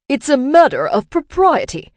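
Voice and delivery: loud, high-pitched outraged bellow. A narrator's reading. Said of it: "he bellowed".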